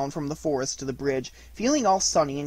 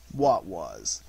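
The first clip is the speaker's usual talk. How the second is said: low, glum donkey; downcast, low-pitched grumble; gloomy Eeyore; 8 semitones lower than usual. Acted. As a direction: ask it low and dully.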